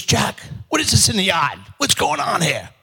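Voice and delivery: high, strained demand to a son; alarmed mother. Acted. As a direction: call out in high-pitched shock. Mother shocked by find in yard.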